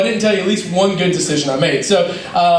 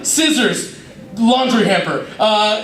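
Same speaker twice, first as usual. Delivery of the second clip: ordinary delivery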